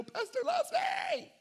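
high squealing mock fan cry; high hammed-up joyful shout; mock gushing shout. Mock excited congregant.

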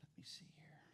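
unvoiced whisper to himself; soft private whisper; whispered aside. Real, not acted. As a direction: mutter it off-mic. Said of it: "aside to himself".

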